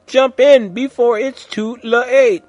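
high, loud, slow urgent call. A narrator's reading. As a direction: call everyone in before it's too late.

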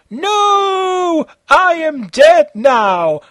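high, loud, slow dying wail; dying monster shout. Acted. Monster dying after the fight, loud slow wail. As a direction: howl out the monster's death. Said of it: "the monster said".

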